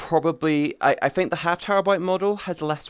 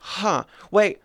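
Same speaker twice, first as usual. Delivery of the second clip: ordinary talk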